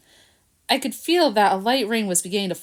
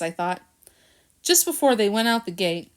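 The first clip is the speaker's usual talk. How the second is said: plain reading voice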